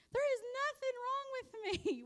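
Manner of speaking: high, squeaky mock protest; mock exasperation. Wife retelling how she snapped at everyone, mock exasperated. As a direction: throw up your hands, pitch way up.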